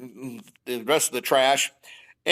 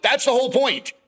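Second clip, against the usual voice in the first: emphatic, higher-pitched protest; exasperated retort; 6 semitones higher than usual. Retold or imitated. Farmer arguing back at the bureau over his blog, exasperated. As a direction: drive the point home, pitched well up.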